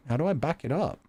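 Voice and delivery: ordinary talk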